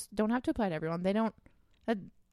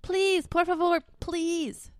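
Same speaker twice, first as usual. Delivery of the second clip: mock pleading; 7 semitones higher, 4 dB louder, much slower than usual. Begging listeners to subscribe.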